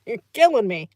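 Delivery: real giggle breaking through the words; laughing. Real, not acted. To her chat about españolza, laughing along. Laughing at chat's made-up word.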